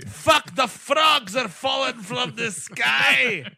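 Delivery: high, slow, loud yelling; hammy Scottish panic. Pretend. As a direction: overact a frantic Scotsman. Parody of movie scene.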